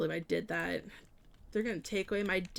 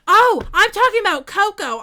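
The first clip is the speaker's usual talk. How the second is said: sudden realization; 12 semitones higher, 15 dB louder than usual. Real, not acted. Realizes her mistake.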